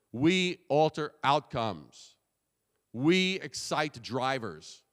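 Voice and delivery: each short phrase spaced out slowly; slow punchy slogans. Shown as a demonstration. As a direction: land every verb like a slogan. Example slogans spoken as a demo.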